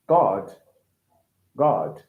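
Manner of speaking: deep, rounded British "God"; British accent. Shown as a demonstration. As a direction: drop low for a British "God".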